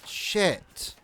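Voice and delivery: angry curse. Real, not acted. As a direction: spit out the curse in shock. Medic killed, player reacts.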